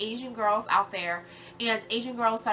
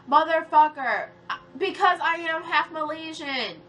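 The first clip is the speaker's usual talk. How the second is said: raised, louder angry retort; real exasperated anger, pitched up; pissed off; 4 semitones higher, 5 dB louder than usual. Real, not acted. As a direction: fire back, fed up and indignant. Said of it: "shit like that pisses me the fuck off".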